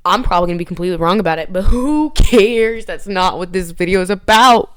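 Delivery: loud, emphatic brush-off; loud, who-cares proclamation; defiant declaration. Real, not acted. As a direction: belt it out like a rallying speech. Defiantly brushes off being wrong.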